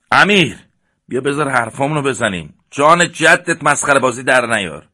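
loud, high call across the park; shouting. Acted. Rashid shouts to Amir to stop joking, loud pleading yell. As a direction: yell at him to stop fooling around. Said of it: "رشید رو به امیر فریاد زد".

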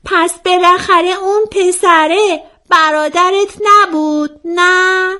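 high, drawn-out questioning voice; probing friend. A narrator's reading. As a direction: press the question slowly in a high pitch. Friend voiced asking a suspicious question.

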